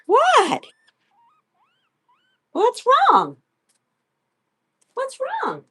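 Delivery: put-on squeaky high baby talk to the guinea pig; high pet voice. Owner to her guinea pig, high squeaky baby talk.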